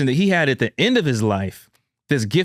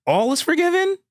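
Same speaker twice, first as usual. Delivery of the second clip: high, incredulous question; astonished question; 14 semitones higher than usual. Retold or imitated. Son asking the dying father, astonished. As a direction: ask it in total disbelief.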